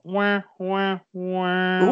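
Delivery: high, held wah-wah noises; mimicked failure sound. Quizmaster telling contestant the answer is wrong, mock failure noise.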